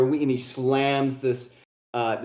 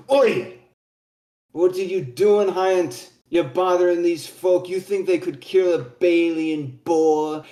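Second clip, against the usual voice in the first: higher, scolding dwarf father; gruff older dwarf; 4 semitones higher, 3 dB louder than usual. Acted. Old dwarf scolding his son for bothering folk, loud scolding voice. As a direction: berate them as an old dwarf, starting on a loud shout.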